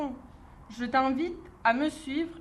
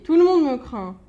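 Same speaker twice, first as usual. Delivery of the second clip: much louder proud boast; mock proud boast; 8 dB louder than usual. Mouse boasting to the gruffalo, loud proud claim. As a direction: boom it out to impress.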